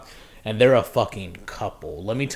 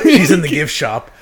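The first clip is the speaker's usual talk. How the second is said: laughing through quote; 5 semitones higher, 12 dB louder than usual. Retold or imitated. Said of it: "He goes".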